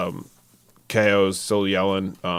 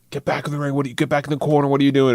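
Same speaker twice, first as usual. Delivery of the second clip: retold yelling; 6 semitones higher, much faster than usual. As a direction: imitate a wrestler yelling at his partner. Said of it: "K O was yelling at him".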